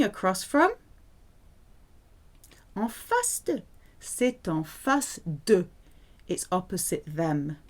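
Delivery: ordinary delivery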